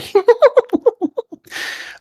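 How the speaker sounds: very high run of squeaky giggles; little giggles. Acted. Water creatures giggle from different spots, high and squeaky. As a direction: titter like tiny water creatures. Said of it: "giggles kinda come from different spots".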